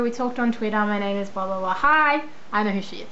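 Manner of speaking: ordinary delivery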